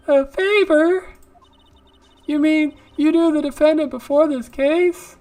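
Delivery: high, surprised woman's voice; female mentor voice. Pretend. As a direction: voice a curious lady boss.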